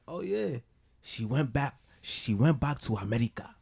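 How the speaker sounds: put-on light African accent, mom voice; a hint of a Nigerian mom's accent. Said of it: "that's not even my legit African voice".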